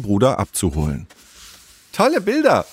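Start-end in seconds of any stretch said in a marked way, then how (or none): 1.9-2.7 high-pitched, delighted little-sister exclamation; beaming. Acted. Little sister Jule sees the painted walls, beaming. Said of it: "strahlte sie".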